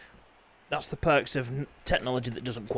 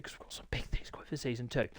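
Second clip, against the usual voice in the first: secretive whisper about next season; 10 dB quieter than usual. Real, not acted. Whispering a teaser to the viewers.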